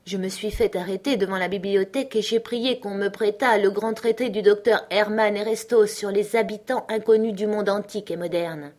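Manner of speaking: plain reading voice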